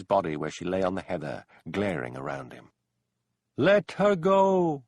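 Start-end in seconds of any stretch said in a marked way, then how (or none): 3.6-4.8 high, loud, very slow unearthly command; ghostly call. A narrator's reading. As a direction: call from the sky above his head. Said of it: "called the voice".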